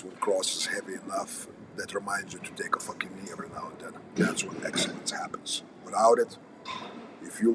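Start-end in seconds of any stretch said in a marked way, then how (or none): 1.8-5.6 whispering. Real, not acted. Hushed emphatic aside.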